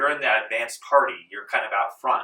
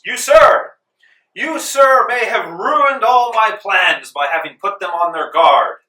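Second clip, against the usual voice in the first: loud, high, furious dressing-down; acted outraged shout, drawing out the accusation; Washington; 9 semitones higher, 12 dB louder, slower than usual. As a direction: yell it as a commander scolding an officer. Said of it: "And Washington yells".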